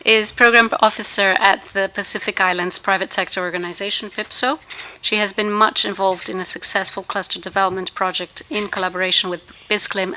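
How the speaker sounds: ordinary talk, nothing marked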